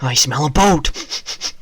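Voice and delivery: loud, slow put-on creature voice; hammed-up loud creature bellow; mock creature voice. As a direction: sniff it out like a greedy monster. Gaming exclamation.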